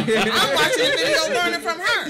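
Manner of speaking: high, loud, laughing through the words; laughing mock admiration. Retelling watching a teen performer online, laughing loudly. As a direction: gush it out between laughs, half joking. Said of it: "I was like".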